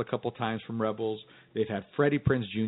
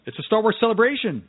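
excited; 10 semitones higher, 5 dB louder, faster than usual. Real, not acted. Excited correction of the title.

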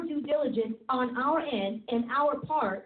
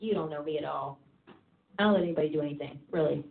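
blunt retort; 10 semitones lower, faster than usual. Retold or imitated. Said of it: "I'm like".

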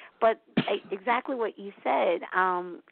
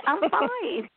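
much higher, chirpy mock cover-up; imitating a forced cheery brush-off; fake cheerful; 11 semitones higher than usual. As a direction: hide the tears behind a bright fake answer. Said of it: "So I, I say".

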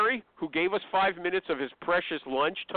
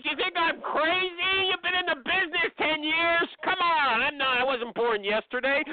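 a recruiter demanding names, pushing hard; pushy; 10 semitones higher than usual. Shown as a demonstration. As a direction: lean on them hard, no nonsense. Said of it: "You gotta get pushy with these people".

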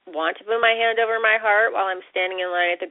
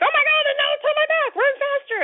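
much higher, fast hammed-up panic; mock panic; faster than usual. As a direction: squeal it like your hair is catching fire. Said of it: "we're like".

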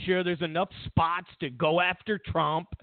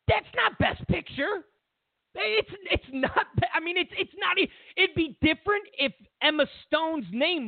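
exasperated; 8 semitones higher than usual. Real, not acted. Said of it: "exasperated rant".

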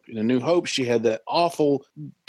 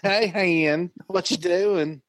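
mock Leia; 5 semitones higher, much slower than usual. Repeats mock Leia greeting Han.

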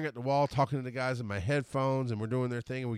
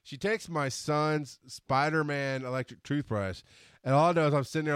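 usual speaking voice

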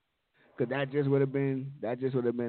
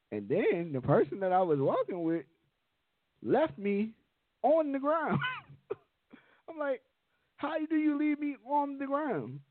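high indignant. Retold or imitated. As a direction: voice climbs high in outrage. Indignant retelling of the fall.

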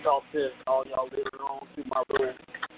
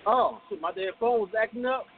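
normal talking voice

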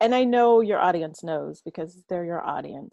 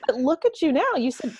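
ordinary talk, nothing marked

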